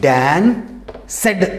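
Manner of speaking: డాన్, pause, సెడ్ as two syllables; split in two. Shown as a demonstration. Teacher showing a two-part reading, syllable by syllable. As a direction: break it into డాన్ and a full సెడ్. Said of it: "అని అంటాం".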